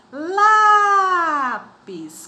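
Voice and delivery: Lápis with the first syllable LÁ held long, high and loud; stretched stress on LÁ. Shown as a demonstration. Teacher stretching Lápis so pupils hear the stress. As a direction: sing out Lápis, drawing out LÁ. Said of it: "Qual a sílaba mais forte?".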